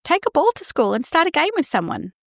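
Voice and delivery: high, bright-voiced quick advice; eager fixing parent.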